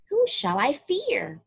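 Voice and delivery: usual speaking voice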